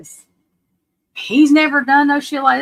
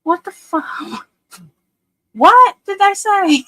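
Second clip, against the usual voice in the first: higher, incredulous mock outburst; imitating brother's disbelief; 9 semitones higher, 4 dB louder, slower than usual. Retelling brother reacting to the press conference, higher disbelief. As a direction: lightly act out the brother's shock. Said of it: "He said".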